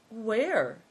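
high, innocent little question; lifted voice, playing innocent. A narrator's reading. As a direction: ask it all innocence. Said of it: "asked Big Tiger innocently".

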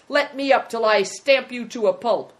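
put-on loud, high, furious boy; screamed threat. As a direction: yell the threat while pinned down. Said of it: "screamed the boy".

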